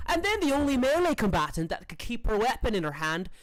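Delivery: high, put-on fighter's voice; boastful Annabelle. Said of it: "And she says".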